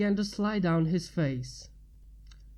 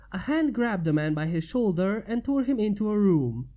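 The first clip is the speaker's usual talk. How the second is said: ordinary delivery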